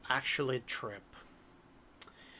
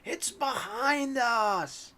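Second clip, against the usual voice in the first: high, stretched-out mock shout of alarm; hammed-up warning cry, slow and high; mock yell; 9 semitones higher, much slower than usual. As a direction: draw the warning out in a high-pitched call. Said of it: "all of a sudden you will yell".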